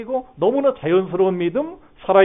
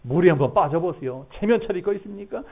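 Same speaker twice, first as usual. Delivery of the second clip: ordinary talk, nothing marked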